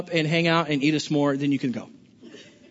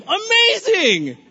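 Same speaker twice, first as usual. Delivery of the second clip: drawn-out amazing; 11 semitones higher, 5 dB louder, much slower than usual. Real, not acted. Pastor to congregation, slow drawn-out amazing. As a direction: stretch the word slowly with a falling finish.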